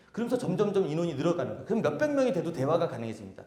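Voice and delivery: normal talking voice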